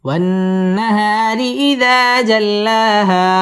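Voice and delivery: slow, high with lengthened alif; slow model recitation. Shown as a demonstration. Teacher repeating the verse after tajweed rules, slow chant. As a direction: stretch the long vowels. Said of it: "এক আলিফ টান দিয়ে ওয়াকফ করতে হবে".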